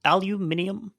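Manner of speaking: Aluminium with five syllables, al-yoo-MIN-ee-um; Aluminium said the British way, stress on MIN; British pronunciation. Shown as a demonstration. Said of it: "we're in Britain".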